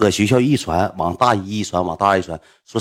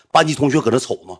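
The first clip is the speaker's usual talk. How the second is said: normal talking voice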